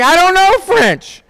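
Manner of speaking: very high, slow, baffled denial; bewildered protest. Retold or imitated. Woman answering people who heard her French, bewildered.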